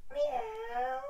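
high playful meow; cat meow. Shown as a demonstration. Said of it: "So to be a cat".

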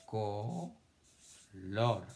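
"Color" drawn out as co, pause, lor; syllable by syllable. Shown as a demonstration. Teacher dictating to children, "Color" syllable by syllable. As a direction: split "Color" slowly into two separate beats. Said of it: "cuántas sílabas tiene color".

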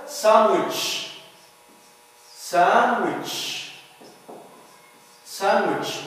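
sandwich as "SAN-wich", no d; sandwich, n running straight into w; silent D. Shown as a demonstration. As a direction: model sandwich slowly with the D dropped. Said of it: "The letter D here is not pronounced".

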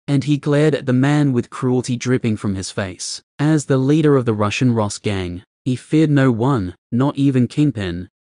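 usual speaking voice

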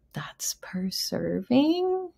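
conspiratorial whisper; whispered aside. Real, not acted. Vet telling viewers the calorie catch, whispered. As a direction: let the punchline slip out in a whisper.

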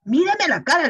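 stern loud reprimand. Retold or imitated. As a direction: raise your voice at the lying child. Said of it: "le levanté la voz ya".

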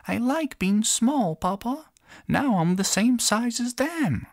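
tiny princess, happy and high; little girl voice. Acted. Little princess speaks to father.

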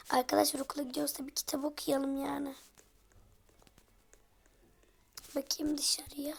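fast hushed whisper; quick whisper to viewers. Real, not acted. Whispering while baby sleeps.

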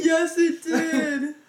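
high, loud and slow, laughing cry; excited agreement. Real, not acted. Agreeing with her friend, excited laughing shout. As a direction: shout it back thrilled.

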